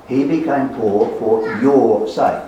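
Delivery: slow line with "your" stretched out; stress on your. Shown as a demonstration. Preacher points congregation to a pronoun, emphatic your. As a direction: punch and lengthen the word "your". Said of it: "there are two personal pronouns".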